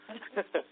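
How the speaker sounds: a brief rueful chuckle, pitched high; short laugh. Real, not acted. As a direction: laugh it off lightly, amused at yourself. Rueful aside about green shoots.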